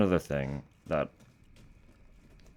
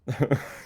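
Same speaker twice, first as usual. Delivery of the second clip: quick burst of laughter; short laugh; 6 semitones higher, 3 dB louder than usual. Real, not acted. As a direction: laugh out loud. Laughing at game character.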